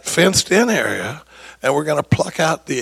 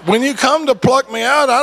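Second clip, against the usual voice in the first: high, laughing through the words; amused high laugh-talk; 8 semitones higher, 5 dB louder than usual. Real, not acted. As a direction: chuckle as you plead your case. Joking about his own case.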